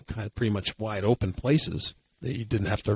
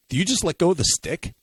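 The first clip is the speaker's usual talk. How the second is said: quoted question; 6 semitones higher, 3 dB louder than usual. Retold or imitated. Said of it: "He's like".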